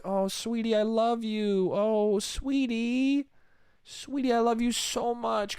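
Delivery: hammed-up syrupy affection; gushing sweetheart. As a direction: coo it like a lovestruck boyfriend. Fed boyfriend turns gushingly affectionate.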